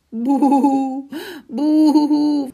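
high, loud, long-held boo-hoo; story-book crying. A narrator's reading. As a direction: wail a hammed-up boo-hoo. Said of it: "weinte sie und schluchzte". (gespieltes, lautes Heulen)

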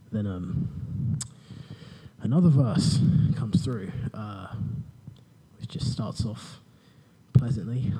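normal talking voice

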